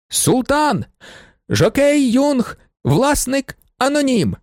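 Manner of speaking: high-pitched, slow calling out; shouted announcement. A narrator's reading. Tall sportsman announcing the winner to the stands, calling out. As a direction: proclaim each item to the stands, drawn out. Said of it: "закричав".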